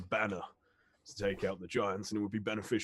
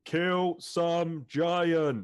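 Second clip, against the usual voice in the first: loud repeat; 9 semitones higher, 8 dB louder, much slower than usual. Acted. Orc repeating his request loudly.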